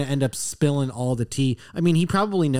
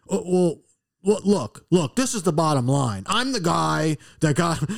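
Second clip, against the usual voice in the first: mock flustered politician, starting higher; Joe Biden; 4 semitones higher than usual. President dodging the reporter's question, flustered imitation. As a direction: stumble into a blustering answer, slowing at the end. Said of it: "Mister Biden".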